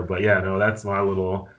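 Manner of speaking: ordinary delivery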